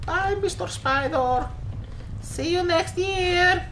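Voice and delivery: put-on high-pitched Eastern European kid accent; little Eastern European child. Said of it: "little Eastern European child".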